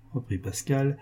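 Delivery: ordinary delivery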